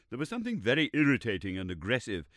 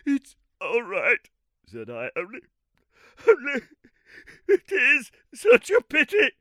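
much higher, louder, broken by sobs; sobbing; 12 dB louder than usual. Acted. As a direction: choke the words out through sobs. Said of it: "I was sobbing".

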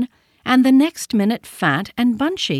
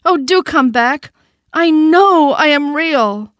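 higher, louder and slow pleading call; 8 semitones higher, 7 dB louder, slower than usual. A narrator's reading. Toy rabbit pleading with the vanished rabbits, loud and slow. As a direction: beg them to come back. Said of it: "called the little rabbit".

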